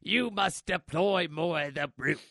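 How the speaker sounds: a lightly put-on stern officer voice; Hux ordering. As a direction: bark orders like a stiff general. Hux orders brute troopers.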